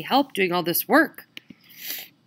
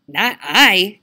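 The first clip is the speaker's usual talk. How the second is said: louder, gruff grumbling dog; 8 dB louder than usual. Acted.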